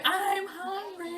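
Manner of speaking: very slow, high mock American; over-exaggerated American English. Shown as a demonstration. Topping friend's demo, absurdly exaggerated high American English. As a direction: stretch it absurdly high, wide-mouthed American. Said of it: "we're just over exaggerating".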